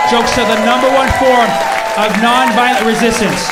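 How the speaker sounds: loud, punchy declaration; emphatic proclamation. Real, not acted. Comedian repeating his claim about jokes, loud proclamation. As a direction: hammer it home to the crowd.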